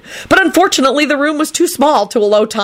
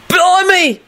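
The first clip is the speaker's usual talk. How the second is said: high-pitched screamed exclamation; acted scream; 12 semitones higher than usual. A narrator's reading. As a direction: cry out high in pained shock. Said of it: "Victor screamed".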